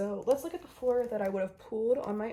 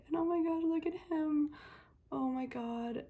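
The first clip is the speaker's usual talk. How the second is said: excited; 7 semitones higher than usual. Real, not acted. Looking at signed photo.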